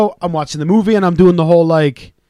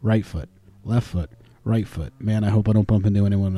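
stoned self-talk; 7 semitones lower, 5 dB quieter than usual. Retold or imitated. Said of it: "having to think my process through".

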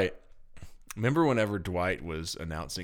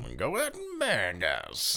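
playful cowboy impression; cowboy twang; 3 semitones higher than usual. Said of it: "I'm just copying you in a country, in a Western voice".

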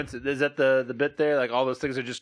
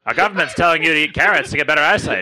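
put-on old newsreel; 4 semitones higher, 6 dB louder than usual. Wartime propaganda ad parody.

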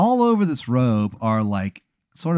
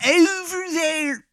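put-on terrible Maryland accent; 4 dB louder than usual. Wizard sends Adam over there, terrible Maryland accent. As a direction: stretch it high in an overdone Maryland accent. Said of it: "it is a terrible Maryland accent".

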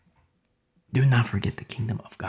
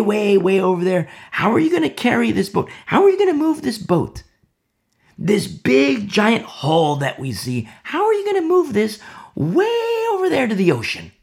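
ridiculing scoffer; 3 semitones higher than usual. Acted. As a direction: scoff at the impossible boat.

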